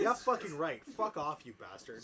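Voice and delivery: loud, high burst easing off; hammed-up yell of outrage; screamed retort. Narrator telling a bastard to fuck off, hammed-up scream. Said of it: "I screamed".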